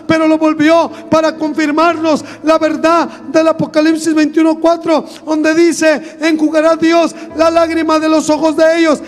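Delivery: loud, rising preacher's declaration; preaching at full force. Acted. Preacher proclaiming heaven to the church, loud and fervent. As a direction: proclaim it to the congregation with fire.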